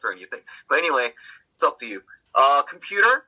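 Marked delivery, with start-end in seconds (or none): 2.6-3.2 "computer" as com-PYU-ter, higher and louder; English pronunciation. Shown as a demonstration. Teacher giving the English word first, "computer" as com-PYU-ter. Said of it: "In English".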